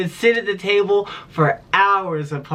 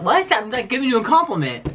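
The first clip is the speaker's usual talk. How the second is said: high mock what, then indignant; offended disbelief; 8 semitones higher than usual. Sibling answering a rude brother, high mock indignation. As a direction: act baffled that a compliment got rebuffed. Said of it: "It's like".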